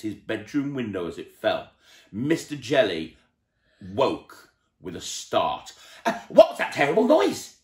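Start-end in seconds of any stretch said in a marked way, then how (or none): 6.0-7.6 high, loud panicked cry; frightened cry. A narrator's reading. Mister Jelly waking in terror, high frightened cry. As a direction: jolt awake in fright. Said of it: "he cried".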